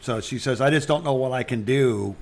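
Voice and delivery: ordinary delivery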